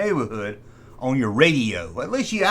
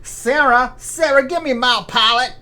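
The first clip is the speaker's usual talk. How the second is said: put-on higher, louder old-fashioned call; a loud, higher call down the hall; 9 semitones higher, 5 dB louder than usual. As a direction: call for Sarah across the house. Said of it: "pick it up and say".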